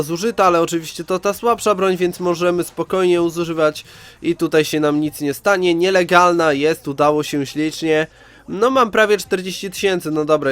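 usual speaking voice

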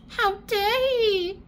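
high, drawn-out indignant cry; mock indignation. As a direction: stretch it out high, as if scandalised. Acted indignant cry at the news.

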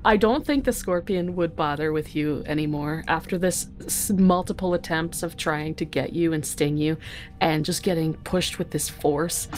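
normal talking voice

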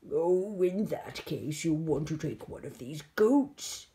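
loud, low monster voice; big creature, drawing out the last words; monster Hetty. Acted. Monster offers George a goat, loud low creature voice.

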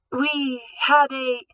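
high, slow, fragile gasp of words; a girl faking weakness for the guards, slow and frail; faint, weak. Acted. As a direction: play it frail, breath running out. Gabrielle plays weak gassed victim.

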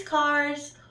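ordinary delivery, nothing marked

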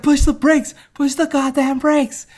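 high, urgent mock shout; panicked yelling. Grandfather orders the kid to brake, panicked high yell. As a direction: yell it like the car is rolling away.

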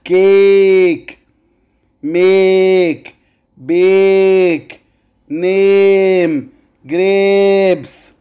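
slow "كيك، ميك، بيك، نيم، جريبس", each vowel drawn out; long vowel. Shown as a demonstration. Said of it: "انا بمد في الايه". (مد الـ a في "كيك، ميك، بيك، نيم، جريبس")

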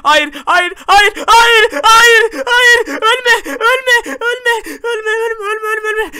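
loud repeated pleas fading in volume; acted desperate denial. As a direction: beg frantically against death. Father begs child not to die. (çaresizce yalvararak feryat)

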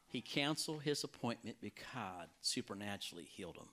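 ordinary delivery

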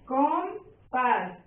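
COM... PÁS, with a gap between; "compás" broken in two, COM rising, PÁS falling; split into syllables. Shown as a demonstration.

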